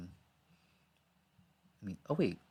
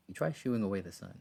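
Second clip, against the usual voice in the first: ordinary talk, nothing marked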